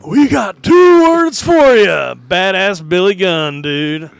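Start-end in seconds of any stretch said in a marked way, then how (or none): 0.1-1.9 slow, high wrestler's holler; loud catchphrase shout. Acted. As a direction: bellow it high, stretching the words. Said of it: "he was loud with that shit".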